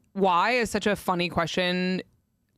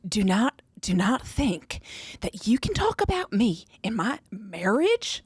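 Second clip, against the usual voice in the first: mock whisper; slower than usual.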